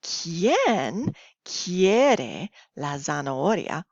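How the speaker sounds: slow, drawn-out question to the class; slow teacher question. Shown as a demonstration. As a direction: ask it slowly, like a kids' show host. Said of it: "So if I say".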